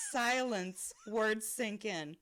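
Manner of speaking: ordinary talk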